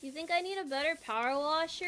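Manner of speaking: ordinary delivery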